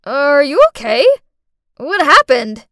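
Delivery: loud, urgent questions; rushing to a fallen child; alarmed call. Acted. Cass finds fallen Clarice.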